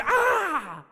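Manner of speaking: sharp high ah cry; preacher demonstrating the demon's exclamation. Shown as a demonstration. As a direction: show what an exclamation mark sounds like. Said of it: "Es una exclamación".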